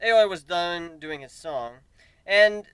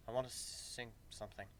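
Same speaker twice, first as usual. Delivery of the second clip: much softer, timid reply; shy; 5 semitones lower, 18 dB quieter than usual. A narrator's reading. Aoi tells Griffin he wants to sing, shy and soft. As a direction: barely dare to ask. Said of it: "he replies shyly".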